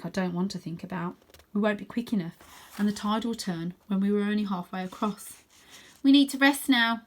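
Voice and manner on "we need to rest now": higher, louder firm leader; firm order. Acted. Stella telling the battered team to rest, firm. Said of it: "says Stella".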